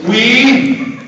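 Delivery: lofty, drawn-out royal disapproval; snobbiest voice. Shown as a demonstration. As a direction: look down your nose at them. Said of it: "your snobbiest and snottiest sounding voice".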